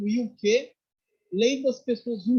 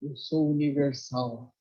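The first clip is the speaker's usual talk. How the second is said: low, slow, deliberate quote; measured, unhurried declaration; master's calm answer; 5 semitones lower, 3 dB quieter, slower than usual. Retold or imitated. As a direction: repeat it serenely, pitched down.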